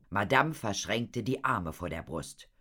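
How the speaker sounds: plain reading voice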